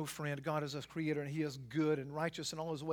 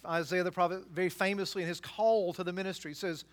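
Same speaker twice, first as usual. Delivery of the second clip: ordinary delivery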